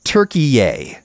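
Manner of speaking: Turkey-yay said as "tur-kee-YAY", ending on a long ay vowel; Turkey-yay with the last syllable rhyming with say. Retold or imitated. Video narrator saying Turkey-yay, ending on ay. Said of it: "the narrator said".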